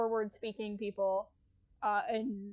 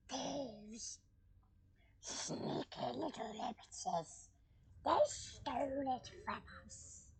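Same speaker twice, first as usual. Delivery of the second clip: creepy put-on Gollum voice, getting softer and slow; Gollum impression; 6 dB quieter, much slower than usual. Showing off his Gollum impression to viewers, creepy creature voice fading softer. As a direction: hunch over and hiss out your grievance like Gollum. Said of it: "Okay, so my Gollum impression".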